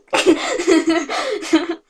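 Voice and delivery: high giggling squeal; squealing laugh. Real, not acted. Fangirling over her ship, a squealing laugh. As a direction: squeal with delight.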